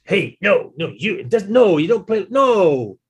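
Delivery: loud, high mock telling-off; retold colleague yelling across the orchestra; shouting down the line. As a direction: bark a correction down the section, half-joking. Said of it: "people were shouting down the line".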